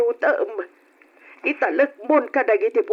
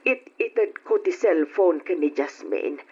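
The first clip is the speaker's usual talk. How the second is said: lightly voiced old woman; 10 semitones lower, 4 dB quieter than usual. Old woman's low reply.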